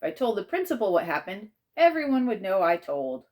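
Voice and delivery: usual speaking voice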